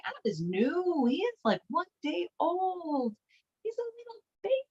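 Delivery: put-on high-pitched, slowed cooing over a baby. Retelling Genesis, picturing Adam as one day old, cooing. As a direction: coo it high like fussing over an infant.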